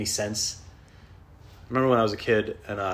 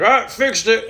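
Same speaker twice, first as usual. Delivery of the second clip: higher, louder breezy claim; lightly voiced repairman; 12 semitones higher, 10 dB louder, slower than usual. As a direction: toss it off like a sloppy landlord. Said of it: "He's like".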